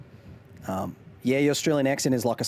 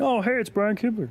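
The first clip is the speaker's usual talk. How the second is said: mock fan's higher, cheery call; imitating an excited fan spotting a celebrity; fan greeting; 9 semitones higher than usual. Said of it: "I get it multiple times at every Magic event".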